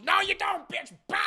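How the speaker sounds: high, loud burst; mock attacking yell. Van Helsing running over to stop Mina, hammed-up yell. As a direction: shout her down mid-lunge.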